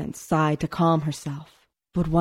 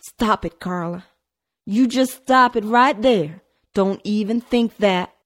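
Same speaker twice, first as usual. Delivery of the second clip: firm trilled scolding; 4 semitones higher, 5 dB louder than usual. A narrator's reading. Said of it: "trilled Melissa".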